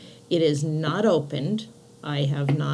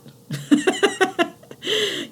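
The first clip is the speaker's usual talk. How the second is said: bright high burst of laughter; 13 semitones higher than usual. Real, not acted. Laughs at own joke.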